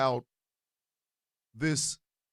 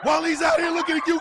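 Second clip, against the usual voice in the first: higher and louder, laughing through it; laughing mock plea; 7 semitones higher, 4 dB louder than usual. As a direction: beg her to fight, cracking up. Urging wife, laughing.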